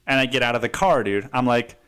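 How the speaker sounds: ordinary talk, nothing marked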